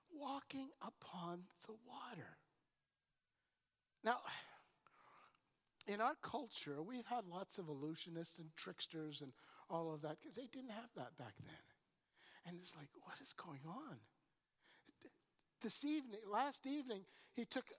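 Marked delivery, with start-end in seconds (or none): 0.1-2.4 whispered in wonder; awed whisper. Acted. Awed narration of Jesus. 13.0-14.0 whispered in disbelief; bewildered whisper. Retold or imitated. Bewildered disciples' reaction.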